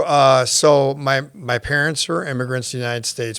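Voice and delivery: usual speaking voice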